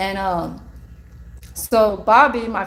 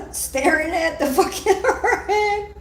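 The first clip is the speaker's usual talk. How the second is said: laughing; 9 semitones higher, slower than usual. Real, not acted. Laughing mid-quote.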